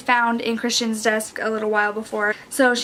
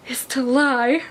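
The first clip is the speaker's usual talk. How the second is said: normal talking voice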